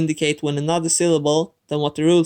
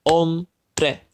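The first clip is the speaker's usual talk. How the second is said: Hombre split into two separate beats, OM, BRE; clap-along Hombre, one syllable per beat. Shown as a demonstration. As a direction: break Hombre into OM, pause, BRE. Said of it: "Let's do the clapping thing again".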